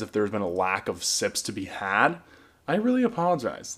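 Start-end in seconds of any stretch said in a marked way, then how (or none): 2.7-3.8 "really" lifted high then falling; higher apology. Real, not acted. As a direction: stress "really" high and let it drop.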